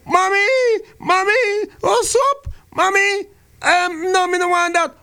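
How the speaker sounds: put-on high whiny boy turning down his mother's soup; whiny little boy. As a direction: whimper it like a small boy refusing soup. Whining boy in acted scene.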